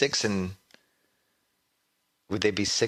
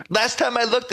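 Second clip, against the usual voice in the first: very high, loud radio rant; Mike Francesa impression; 13 semitones higher, 3 dB louder than usual. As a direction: shout the boast like Francesa. The original rant line.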